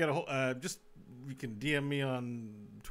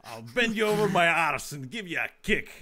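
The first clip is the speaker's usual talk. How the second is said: loud mock Scottish insult; a hammed-up Scottish tough threatening; Scottish accent; 2 semitones higher, 6 dB louder than usual. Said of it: "your Scottish always".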